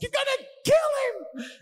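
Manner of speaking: very high, loud mock shriek; drawn-out hammed-up scream; screaming sister. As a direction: mimic a hysterical sister screeching in panic. Acts out sister screaming at dad.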